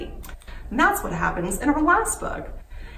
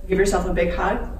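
usual speaking voice

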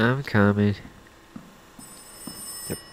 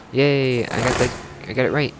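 bright, louder little victory cheer; excited cheer; 4 semitones higher, 6 dB louder, faster than usual. Real, not acted. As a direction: celebrate the solved puzzle. Solved the code.

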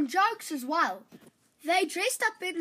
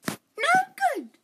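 much higher, louder rejection; dismissive princess; 11 semitones higher, 5 dB louder than usual. Acted. Princess rejecting the second clever son, high and loud. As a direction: wave him off impatiently.

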